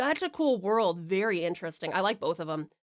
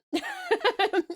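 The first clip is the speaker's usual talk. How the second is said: laugh; 12 semitones higher than usual. Real, not acted. Laughing at her own accent limits.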